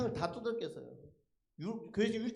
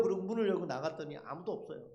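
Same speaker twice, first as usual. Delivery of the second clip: ordinary talk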